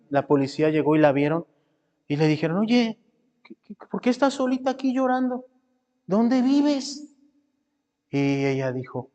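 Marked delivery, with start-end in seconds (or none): 2.6-7.1 higher-pitched questioning; lightly voiced patrolman. Patrolmen asking a crying little girl, lightly acted, higher-pitched. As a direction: lift the pitch to question a child.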